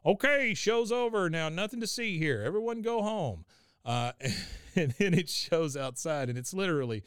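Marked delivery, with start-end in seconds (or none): 0.0-3.4 high-pitched mock call to onlookers; Hank. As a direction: call out as if dispersing a crowd. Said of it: "and he goes".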